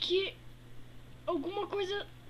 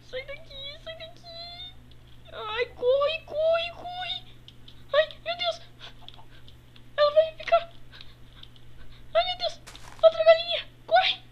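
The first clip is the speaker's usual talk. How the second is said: high frantic yelling; panicked screaming; 11 semitones higher, 6 dB louder than usual. Acted. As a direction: run for your life, shrieking. Fleeing chickens, screaming. (gritos desesperados)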